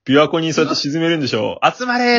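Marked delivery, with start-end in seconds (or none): 1.6-2.2 higher-pitched mock rallying cry; mock calling out. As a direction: call everyone to gather, pitched up. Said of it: "っつって琵琶湖に沈めるのが背脂さんの技".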